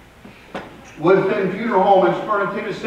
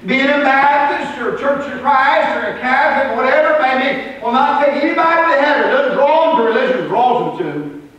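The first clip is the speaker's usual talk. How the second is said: preaching at full force; 10 semitones higher, 4 dB louder than usual. Acted.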